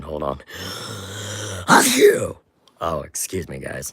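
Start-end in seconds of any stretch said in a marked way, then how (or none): 0.4-1.6 sharp breath in before a sneeze; sneeze build-up gasp. Real, not acted. 1.7-2.4 big real sneeze, high and loud. Real, not acted. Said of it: "I need to sneeze again".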